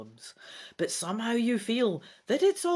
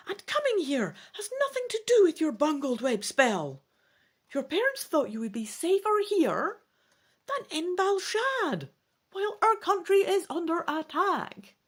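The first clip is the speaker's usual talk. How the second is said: elven tutor voice; 11 semitones higher than usual. A narrator's reading.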